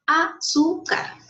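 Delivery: 'Azúcar' slowly split as a-zú-car; syllable by syllable. Shown as a demonstration. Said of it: "Así está separado en sílabas". ('Azúcar' dicho lento, separado en sílabas)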